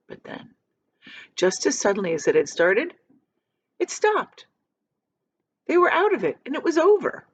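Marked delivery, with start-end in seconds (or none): 3.8-7.2 much higher, bright with relief; sudden relief. A narrator's reading. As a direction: let the relief burst out. Sudden relief after storm.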